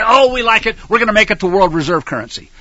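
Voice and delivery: mock them as brash and proud; mocking the nation. Preacher mocks America answering God, loud and sneering. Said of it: "You say".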